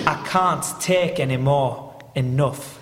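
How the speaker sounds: "can't" as broad "cahnt", slow Yorkshire line; Yorkshire accent. Shown as a demonstration. Actor performs his line in Yorkshire accent for the host. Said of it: "I decided she was crazy".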